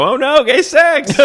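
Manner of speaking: high, drawn-out mock cry of horror; mock scream. Retelling the two guys in bed, mock scream. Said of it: "they start screaming and go".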